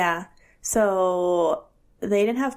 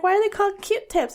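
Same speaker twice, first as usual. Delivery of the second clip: put-on curious child; 11 semitones higher, faster than usual. Son asking, retold.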